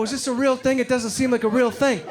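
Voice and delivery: high, doubting question; skeptical patient. Retold or imitated. Patient doubting his dentist, mock skeptical.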